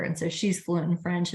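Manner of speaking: ordinary talk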